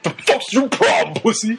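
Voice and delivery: high, hammed-up tough-guy snarl; mock picking a fight; aggressive taunt. Retold shoving a teacher.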